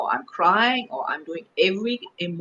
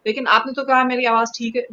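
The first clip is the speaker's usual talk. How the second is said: quick protest; 6 semitones higher, 9 dB louder, faster than usual. Retold or imitated. Her protest to director.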